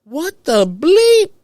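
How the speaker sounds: high, loud slow "what the bleep"; stunned panicked outcry; outraged disbelief. Retold or imitated. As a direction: shriek it in horrified disbelief. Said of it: "I'm like".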